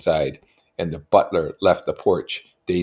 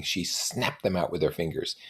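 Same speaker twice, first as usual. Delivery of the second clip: ordinary delivery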